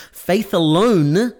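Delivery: slow, loud "Faith alone", ALONE stretched out; stress on ALONE. Real, not acted. Preacher rewording Saint James for the congregation, stressing ALONE. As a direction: hit and draw out the word "alone". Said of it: "I have to stress the word alone".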